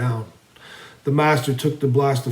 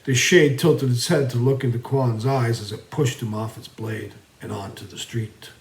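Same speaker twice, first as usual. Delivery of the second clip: ordinary delivery, nothing marked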